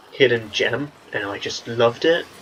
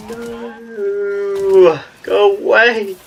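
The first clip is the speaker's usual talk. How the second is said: high, loud panicked plea; 9 semitones higher, 4 dB louder, much slower than usual.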